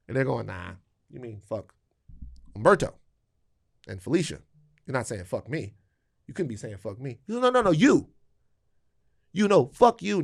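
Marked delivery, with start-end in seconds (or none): 0.5-7.2 quiet, low denial with pauses; understated mock incredulity; acted disbelief. Supporters refusing to believe, acted. 7.4-10.2 high-pitched, emphatic contradiction; acted exasperated insistence; insistent correction. Trump insisting to disbelieving supporters.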